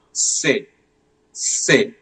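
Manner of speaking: "Se" from the middle of the tongue; soft S sound. Shown as a demonstration. Teacher drills French C for the class, soft S sound. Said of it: "It comes from the middle of your tongue".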